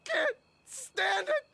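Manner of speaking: high, tearful exasperated plea; desperate wail. Acted. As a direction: wail it high, at the end of your rope. Desperate plea about the recital.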